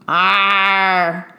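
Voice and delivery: low gruff pirate arr; pirate growl. Acted. Enemy pirates snarling from their ship, low growl. Pirates looking angry and mean.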